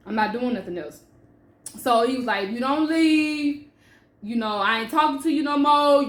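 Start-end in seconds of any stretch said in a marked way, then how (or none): 2.5-6.0 raised, firm warning; imitating fiance's ultimatum. Fiance warning her to leave, firm and raised. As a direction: quote him laying down the law.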